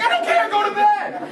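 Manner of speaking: very high mock-furious scream; retold parental meltdown; frazzled dad yell. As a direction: yell over the kids' protest, hammed up. Dad yells back at kids.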